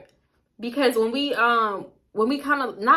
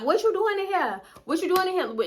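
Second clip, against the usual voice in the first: high mock fake Louisiana accent; Phony; 10 semitones higher than usual. Phony barging in the door, high fake Louisiana accent. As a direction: mimic a little fake Louisiana accent, pitched way up. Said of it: "In her little accent, her little fake accent".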